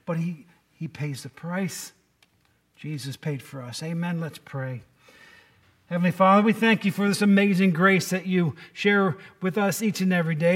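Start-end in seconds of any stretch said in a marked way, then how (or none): 5.9-10.6 earnest spoken prayer to God; closing prayer, louder and devout. Acted. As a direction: pray it aloud for the whole room. Pastor opens a prayer.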